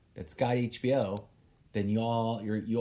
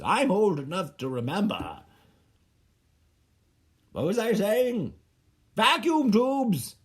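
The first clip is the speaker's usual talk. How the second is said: higher and louder, insisting with mock outrage; worked-up rant; 8 semitones higher, 5 dB louder than usual. Real, not acted. Defending vacuum tubes to young viewers, a loud excited rant.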